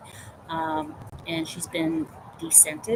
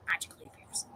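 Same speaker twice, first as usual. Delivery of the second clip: whisper; 14 dB quieter, faster than usual. Real, not acted. Repeated in a whisper.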